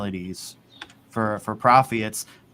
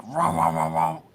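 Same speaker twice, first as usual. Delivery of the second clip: higher-pitched repeated "rah" noise; imitated generic spin sound; 6 semitones higher than usual. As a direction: mimic a looping spin sound. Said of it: "like, the generic".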